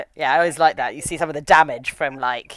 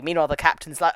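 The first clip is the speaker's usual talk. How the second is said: normal talking voice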